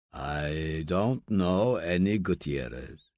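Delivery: put-on low, slow old man's voice, scornful; an ailing father mocking his son, slow and low; a slow low old man, needling; gruff old father. Rubio denies knowing.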